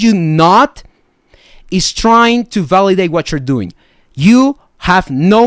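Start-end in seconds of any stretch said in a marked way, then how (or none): none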